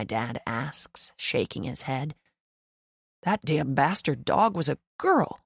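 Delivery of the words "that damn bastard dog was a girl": grumbling repeat. A narrator's reading. Dad repeating the news about the hated dog, disgusted. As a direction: repeat it sourly to yourself. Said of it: "he repeats".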